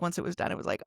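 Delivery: normal talking voice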